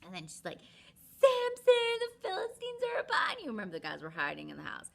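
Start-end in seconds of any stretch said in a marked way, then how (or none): 1.2-3.5 very high, loud warning shout; alarm call. Retold or imitated. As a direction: shriek the warning.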